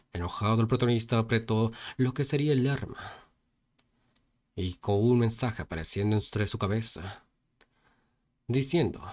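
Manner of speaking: ordinary delivery, nothing marked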